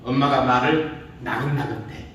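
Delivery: ordinary talk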